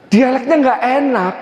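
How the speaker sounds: ordinary delivery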